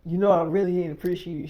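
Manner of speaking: loud offended complaint; indignant. Retold or imitated. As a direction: call her out loudly for the insult. Offended friend confronts her.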